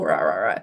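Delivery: very low growly barking imitation; low mock Jack Russell barks; mock dog bark. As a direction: bark like an angry little terrier.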